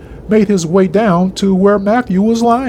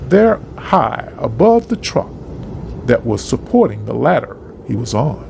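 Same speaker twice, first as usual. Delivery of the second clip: ordinary delivery, nothing marked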